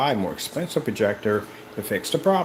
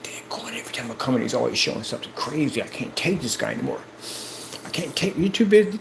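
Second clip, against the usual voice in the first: muttered mock grumble; 2 semitones higher than usual. Mimicking a naysayer who grumbles under his breath. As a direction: mutter it under your breath like a sore rival. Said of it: "they get upset and angry".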